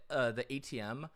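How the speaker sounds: ordinary delivery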